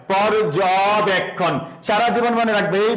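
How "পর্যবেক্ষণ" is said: পর্যবেক্ষণ said slowly, each part pronounced clearly; slow careful demo. Shown as a demonstration. Teacher showing students how পর্যবেক্ষণ splits, slow and careful. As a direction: break পর্যবেক্ষণ down slowly for the class. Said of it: "জয়ের পরে একটা অ উচ্চারণ হয়".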